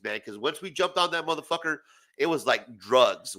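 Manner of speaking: usual speaking voice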